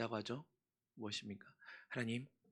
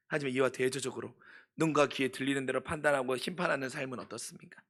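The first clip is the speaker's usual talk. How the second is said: ordinary talk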